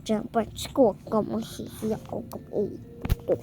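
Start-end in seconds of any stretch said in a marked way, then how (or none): 2.1-3.4 soft unvoiced whisper. Shown as a demonstration. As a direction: breathe it without voice. Repeats line, then whispers it.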